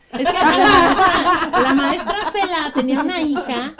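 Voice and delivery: laughing through the words, slow and loud. Real, not acted. Recalling her teacher crush to friends, laughing. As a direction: giggle out the memory of the teacher.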